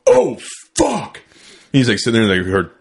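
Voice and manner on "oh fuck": high yelping oh fuck, much higher; dad's startled cry. Retold or imitated. Dad yelping when the slug hits the log. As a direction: squeal it out in shock. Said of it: "my dad's like".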